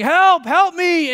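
long, high, loud cries; yelling for help. Retold or imitated. Stranded climber begs for rescue, drawn-out yell. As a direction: drag out a desperate shout. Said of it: "And so he's yelling".

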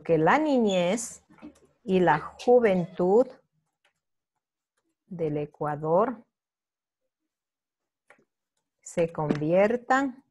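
slow, each phrase set apart with pauses; slow dictation. Shown as a demonstration. Teacher dictating the answer to the class, slowly. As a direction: lay out the answer slowly for note-taking.

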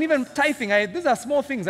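usual speaking voice

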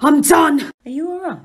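ordinary talk

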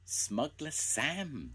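high, drawn-out exclamation; excited cry. A narrator's reading. Children amazed at their luck, crying out. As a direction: exclaim slowly, thrilled at the discovery. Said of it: "they cried".

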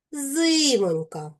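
"Зимонька" slowly, with a high, drawn-out и; stressed и. Shown as a demonstration. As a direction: stretch the first и in "Зимонька" to show the stress. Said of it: "в наголошеній позиції пишемо букву и".

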